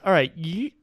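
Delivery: ordinary talk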